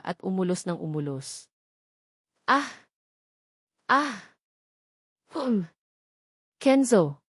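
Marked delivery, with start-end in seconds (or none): 2.5-5.7 acted moans. Heroine moaning during sex. 6.6-7.2 crying out his name, louder and higher; almost a shout, calling his name in passion; half-shouted name. Acted. Lover crying out her partner's name, near a shout. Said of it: "Halos pasigaw kong bigkas".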